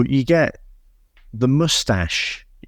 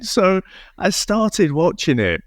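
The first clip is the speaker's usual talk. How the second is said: normal talking voice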